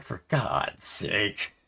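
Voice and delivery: fed up, drag it out; weary exasperation; exasperated. Retold or imitated. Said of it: "So I'm just like".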